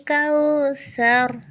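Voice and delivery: कौसर slow, breathy sa; tajweed demo. Shown as a demonstration. Teacher recites कौसर with flowing breath. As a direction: let breath flow on sa of कौसर. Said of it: "सा में सांस जारी करेंगे".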